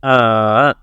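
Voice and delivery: "Uh." falling low then rising; dipping third tone. Shown as a demonstration. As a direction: dip "Uh." down and back up. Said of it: "The third tone is like this".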